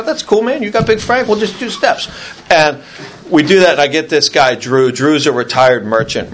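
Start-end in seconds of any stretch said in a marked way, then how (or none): 0.0-2.1 mock other guy's voice.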